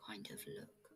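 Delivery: faded whisper; breathed whisper. A narrator's reading. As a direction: let it fade to a whisper. Whispered end of line.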